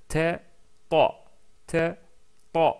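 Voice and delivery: « ta » appuyé, répété plusieurs fois; ta emphatique répété. Shown as a demonstration. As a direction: insistez sur chaque « ta ». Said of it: "en insistant un peu plus sur le ta". (répétition du « ta » emphatique)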